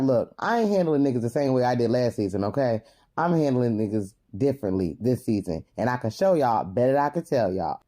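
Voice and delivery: lightly voiced tough talker, pitched low; imitating a firm warning in a low voice; quoted warning. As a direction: lay it down low, mock-serious retelling. Acting out a confessional warning.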